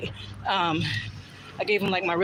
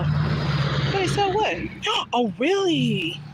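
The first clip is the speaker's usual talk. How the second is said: incredulous; 10 semitones higher, much slower than usual. Real, not acted. Disbelief at chat claim.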